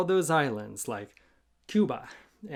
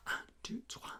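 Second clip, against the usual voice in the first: whispered count; 16 dB quieter than usual. Shown as a demonstration. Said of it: "whispered count".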